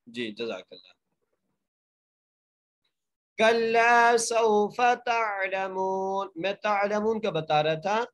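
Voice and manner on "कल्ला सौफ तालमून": very slow raised tajweed chant; Quranic recitation. Shown as a demonstration. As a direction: stretch कल्ला and the meem of तालमून. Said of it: "मीम को एक अलिफ की मकदार खींच".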